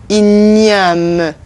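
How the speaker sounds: "Igname" as een-yahm, ny sound kept; "Igname" again, the gn as ny; French igname again. Shown as a demonstration. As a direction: repeat "Igname" carefully with gn as ny. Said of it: "Repeat after me".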